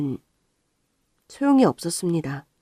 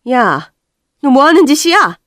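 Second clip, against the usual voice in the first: angry yell; 6 semitones higher, 10 dB louder than usual. Acted. Mother yells at daughter.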